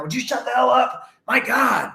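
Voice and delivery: high, loud exasperated yelling; mock angry yell at kids. Dad yelling at his screaming kids in the car, high and loud. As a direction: snap at the kids in the back seat. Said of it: "Is that what you sound like".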